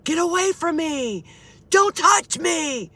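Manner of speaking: high, drawn-out mock yell of a panicked father; imitated screaming. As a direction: act out Dad yelling from the bedroom. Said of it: "you could hear my father yelling in the bedroom, screaming".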